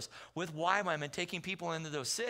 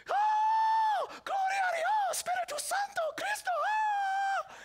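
shrieked much higher and much louder, a woman beside herself with joy; ecstatic Spanish praise; 4 dB louder, slower than usual. Retold or imitated. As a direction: cry out praise in Spanish like she's overwhelmed. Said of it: "She was excited".

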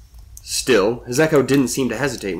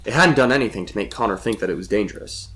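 usual speaking voice

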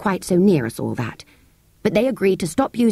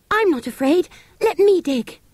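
high, eager cry; bold cry; 11 semitones higher than usual. Acted. Girl insists on digging, high eager cry. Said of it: "Anthea cried out".

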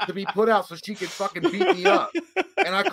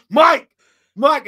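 high, loud re-enacted call; yelling for a friend; 10 semitones higher, 10 dB louder than usual. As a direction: shout the name across a parking lot. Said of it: "I was yelling for Mike".

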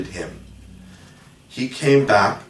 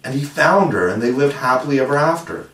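lightly excited storybook voice, pitched up; 5 semitones higher, 3 dB louder than usual. A narrator's reading. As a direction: blurt it out with raised, eager pitch. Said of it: "said Wendy excitedly".

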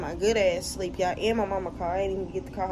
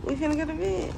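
high, rising sing-song on BED; playful repeat; 8 semitones higher, faster than usual. Real, not acted. Repeating bedtime plan to questioning partner, playful high rise. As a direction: say it higher, lifting BED up at the end. You said what now?.

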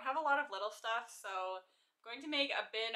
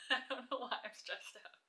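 stressed laugh; 3 semitones higher than usual. Real, not acted.

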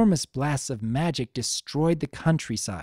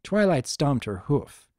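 usual speaking voice

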